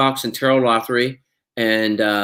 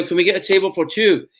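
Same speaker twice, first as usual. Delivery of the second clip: put-on higher Scottish-accented request; 6 semitones higher than usual. Actor asks doorman for a table, Scottish accent. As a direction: ask breezily in a Scottish accent. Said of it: "speak with a Scottish accent".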